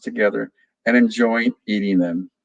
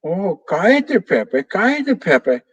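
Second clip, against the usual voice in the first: high parroting of the scolding; parrot mocking back; 8 semitones higher, slower than usual. Parrot Pepe mimics Vero's scolding, high cheeky parrot echo.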